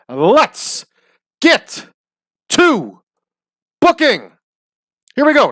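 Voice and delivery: high, slow, word-by-word hype call; hammed-up announcer catchphrase. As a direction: stretch each word like a show opener. Signature show opener catchphrase.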